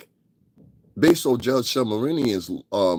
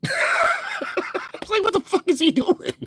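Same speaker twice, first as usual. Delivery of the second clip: very high, breaking up with laughter; laughing while talking; slower than usual. Real, not acted. Baffled by the singer's fake voice, laughing through words. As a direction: squeal it out laughing. Cracking up after the impression.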